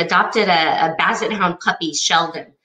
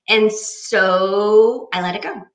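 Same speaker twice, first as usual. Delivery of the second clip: ordinary delivery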